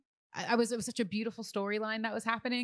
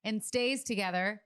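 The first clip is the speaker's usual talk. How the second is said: podcast host intro; 3 semitones higher, 4 dB louder than usual. Acted. Host welcoming listeners to the show in a lively intro. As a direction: open the show like a radio host.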